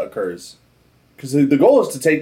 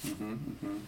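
usual speaking voice

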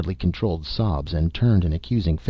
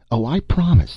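put-on boy's eager promise, louder and higher; eager cry; 7 semitones higher, 6 dB louder than usual. A narrator's reading. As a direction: burst out with a child's eagerness. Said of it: "the boy cried eagerly".

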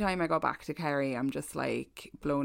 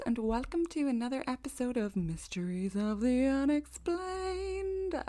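slow, higher show-host welcome; announcer intro; 6 semitones higher, 3 dB quieter than usual. Acted. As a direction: open the show like a presenter. Podcast intro, announcer style.

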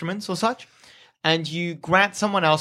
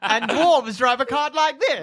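laughing through a comic's setup; hammy observational comedian; stand-up comic voice; 7 semitones higher than usual. Acted.

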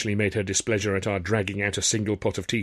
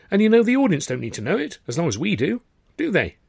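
higher, brisk approving chat; approving Tilly; 7 semitones higher, 3 dB louder than usual. A narrator's reading. Tilly to Arthur approving the story, brisk and higher. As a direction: warm to the idea.

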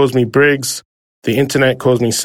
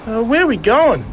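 put-on higher, eager young fella's voice; young Elvis; 9 semitones higher than usual. Lost bush kid asking the driver for a lift, eager. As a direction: ask it brightly like a hopeful bush kid. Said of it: "asked Elvis".

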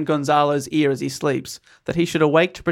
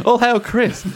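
raised, mock-ceremonial hail; mock acclamation; 6 semitones higher, 4 dB louder, slower than usual. Mock subjects greeting their new king, raised voice.

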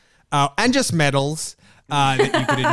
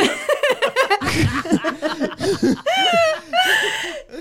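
long high laugh. Real, not acted. Host laughs at their own pointed question, high laughter. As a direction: burst out into helpless high laughing.

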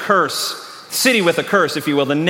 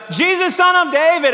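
retold yell; 10 semitones higher than usual. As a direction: call out high as the blind beggar. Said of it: "he yells out".

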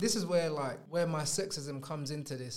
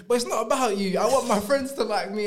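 loud, higher hammed-up protest; retold meltdown at full pitch; mock tearful outburst; 5 semitones higher, 7 dB louder than usual. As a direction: imitate a distraught girlfriend cutting off comfort. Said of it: "Then she starts crying".

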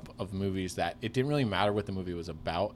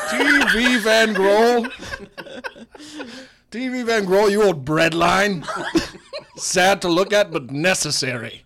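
louder, higher roast; old-money roast; 11 semitones higher, 13 dB louder, slower than usual. Pretend. A rich man roasting a friend at a gala, louder. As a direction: rib your friend grandly at a gala. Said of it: "He's like".

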